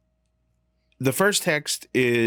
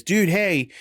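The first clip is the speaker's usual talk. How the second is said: a laid-back higher surfer greeting; surfer dude; 8 semitones higher than usual. Retold or imitated. Reading the text in his head as a chill surfer. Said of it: "surfer dude".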